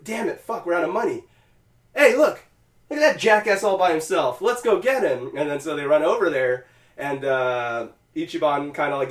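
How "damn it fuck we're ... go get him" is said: lightly acted street thugs, higher pitch; retold thugs. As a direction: play roused thugs spotting a target. Voicing broke thugs outside gambling hall.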